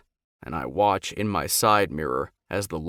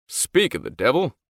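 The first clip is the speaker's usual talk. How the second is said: grinning; 5 semitones higher, 4 dB louder than usual. A narrator's reading. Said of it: "Quinn grins".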